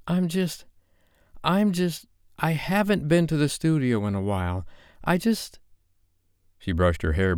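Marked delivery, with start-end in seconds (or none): 0.1-5.6 put-on higher young woman's voice, faltering and defensive; Sophie, flustered. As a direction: stumble over the words as Sophie, caught off guard.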